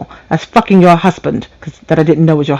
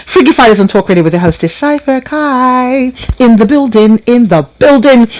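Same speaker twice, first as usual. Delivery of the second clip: radio host ID; 4 semitones higher than usual. Acted. Host announces her talk radio show and name.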